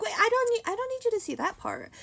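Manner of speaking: high, loud flustered blurt; panicked protest. Retold or imitated. Telling Lex not to read the back, panicked. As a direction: hurriedly stop someone reading, hammed-up panic. Acting out her panic at reveal.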